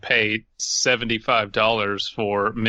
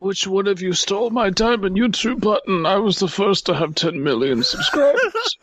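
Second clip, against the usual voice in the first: put-on old-timer voice; 8 semitones higher than usual. Friend playing an elderly YouTuber, high old voice. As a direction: ham up a boastful grandpa.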